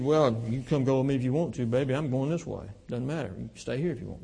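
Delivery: lightly voiced offhand husband, brushing her off; imitating a dismissive husband to his wife; mock careless attitude. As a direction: toss it off like you don't care. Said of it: "I may have the attitude".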